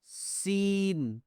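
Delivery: سین drawn out very slowly with a long hissing s; whistling seen. Shown as a demonstration. Teacher showing pupils the whistling سین. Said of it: "سیٹی کی طرح آواز ہوتی ہے".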